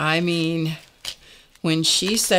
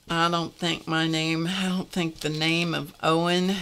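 usual speaking voice

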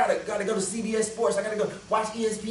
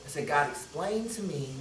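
humble prayer; 6 semitones lower, 7 dB quieter than usual. Retold or imitated. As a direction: pray it humbly and quietly. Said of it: "let me change my prayer".

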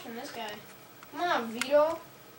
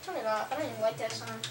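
ordinary talk

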